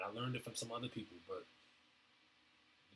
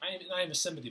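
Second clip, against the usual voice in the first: emphatic raised voice; 8 semitones higher, 8 dB louder, faster than usual. Real, not acted. Insisting he wants understanding.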